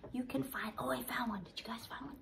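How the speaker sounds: hushed, secret searching whisper; playful whisper. Acted. Host whispers while kids hunt the tiniest potato. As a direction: whisper like hunting something tiny.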